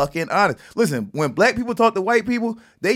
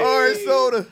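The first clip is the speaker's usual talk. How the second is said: laughing; 13 semitones higher than usual. Real, not acted. Echoing laughing joke.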